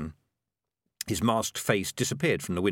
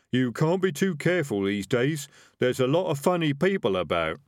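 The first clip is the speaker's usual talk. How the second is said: higher, chatty rogue voice; animated highwayman small talk; 6 semitones higher, 4 dB louder than usual. Acted. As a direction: confide it like a cautious tradesman.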